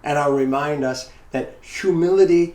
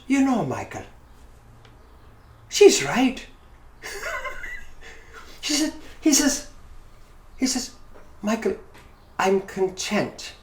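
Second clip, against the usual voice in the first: Irish brogue; 5 semitones higher, 6 dB quieter than usual. Retold or imitated. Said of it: "I sometimes utilize the brogue".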